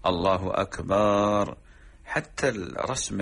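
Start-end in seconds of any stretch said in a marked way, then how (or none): 0.1-1.6 slow أكبر held as "akbaaar"; stretched ba. Shown as a demonstration. Sheikh imitating some muezzins' mistake, stretched ba. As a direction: stretch the last syllable of أكبر on a long aa. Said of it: "مد الباء في أكبر".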